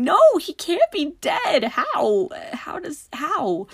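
reliving the shock of Sirius dying, crying out high and loud; anguished disbelief. Retold or imitated. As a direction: protest like you cannot accept it.

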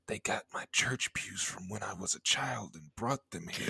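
hushed, scared whisper of a paranoid thought; frightened whisper. Retold or imitated. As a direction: whisper it like you fear being overheard. Retelling her frightened thought in church.

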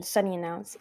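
plain reading voice

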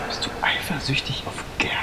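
near-whispered, soft probing; hushed incredulous question. Acted. Man asking his friend about jealousy, hushed disbelief. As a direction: drop to a whisper as realisation dawns.

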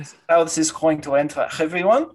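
put-on solemn Russian-accented farewell. Doctor gathering everyone for a last goodbye, Russian accent. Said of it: "very Russian man".

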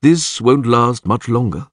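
slow, gloomy warning. A narrator's reading. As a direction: say it slowly, expecting the worst. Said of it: "Crep said gloomily".

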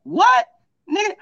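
high-pitched mock-incredulous "what"; startled disbelief. Man retelling his reply to a stranger's proposition, incredulous. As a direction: retell it as a sharp, high double take.

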